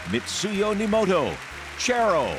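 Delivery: ordinary talk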